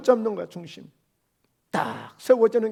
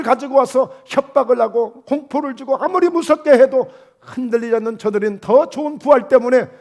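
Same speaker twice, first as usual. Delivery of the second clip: preaching; 3 semitones higher, 4 dB louder than usual. Acted. Preaching at building intensity.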